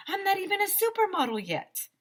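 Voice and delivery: high, whiny protest; indignant protest. Acted.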